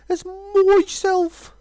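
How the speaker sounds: put-on as myself in a Brummie accent, myself said as mɔsɛlf; the vain dragon slipping into Brummie. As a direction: drag out as myself in Birmingham English. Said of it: "He gets a little bit brummy sometimes".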